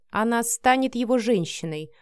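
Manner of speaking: plain reading voice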